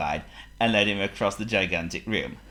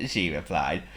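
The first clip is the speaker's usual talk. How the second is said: plain reading voice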